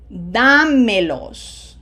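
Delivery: Dámelos, slow, with a loud long DÁ; stress on third-last syllable. Shown as a demonstration. Teacher letting students verify, slow Dámelos stressed on DÁ. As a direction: punch and hold DÁ in Dámelos. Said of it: "on the third syllable. Third from the right".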